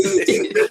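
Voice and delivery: sudden high giggle; high, amused laugh; high burst of laughter. Real, not acted. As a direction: break into a squeaky laugh. Laughing at [name]'s voice.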